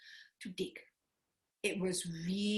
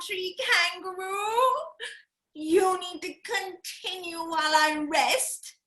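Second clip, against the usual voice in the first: hammed-up whining koala; 9 semitones higher, 4 dB louder, slower than usual. Koala faking a thorn injury.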